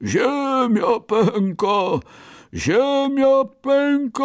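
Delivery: drawn-out, high-pitched bear bellow; booming bear cry. A narrator's reading. Bear charges ahead warning everyone, drawling booming cry. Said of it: "rozwlekłym, tubalnym, niedźwiedzim głosem".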